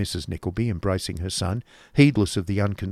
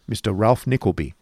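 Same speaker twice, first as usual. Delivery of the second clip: ordinary delivery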